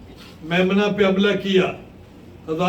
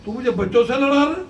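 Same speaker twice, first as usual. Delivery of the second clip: angry insult. Acted. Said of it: "تو ارزق غصے میں آ گیا".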